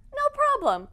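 sarcastic. Acted. Author answering a heartbroken fan, sarcastic. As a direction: chirp it with fake cheer.

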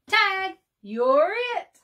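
high, loud and bouncy; playful tag call. A narrator's reading. Bird daring Beaver to play tag, excited and loud. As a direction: toss it out like a dare to chase you.

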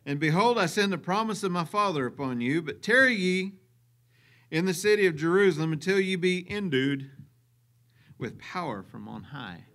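solemn scripture reading. Acted. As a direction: read it like a preacher. Reads scripture aloud.

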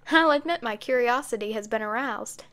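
usual speaking voice

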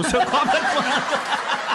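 can't hold it in on the last words; laughing through the punchline. Real, not acted. Telling the dentist joke's punchline, laughing through it.

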